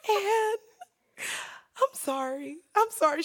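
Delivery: mock tearful apology, voice pitching up; imitated crying. Dumped client apologizes to therapist, imitated crying. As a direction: act out breaking down while apologizing. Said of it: "not gonna see me crying".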